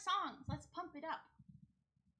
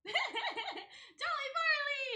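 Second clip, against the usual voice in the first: laughing; 11 semitones higher, 5 dB louder, much slower than usual. Real, not acted. Greeting new viewer laughing.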